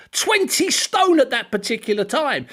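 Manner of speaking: usual speaking voice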